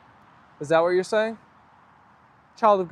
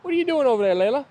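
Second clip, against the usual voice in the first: higher doggy talk; pet talk; 6 semitones higher, faster than usual. Real, not acted. Talking to the dog.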